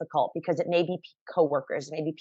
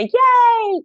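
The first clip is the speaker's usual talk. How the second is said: squealed high and loud yay; over-the-top fake celebration; mock cheer; 9 dB louder than usual. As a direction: shriek it like a cheerleader. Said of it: "It wasn't like a".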